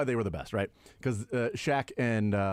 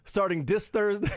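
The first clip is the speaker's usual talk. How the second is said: high mock reading; 10 semitones higher than usual. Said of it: "then he said".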